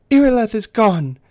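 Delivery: high, loud dismay; alarmed elf. Acted. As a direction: realize another one is gone. Elf reports a lost comrade.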